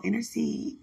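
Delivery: normal talking voice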